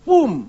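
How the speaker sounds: loud, high burst; a preacher mimicking pent-up anger exploding; explosion sound. Shown as a demonstration. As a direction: blow the word out like a bomb. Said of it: "ikut keluar semua".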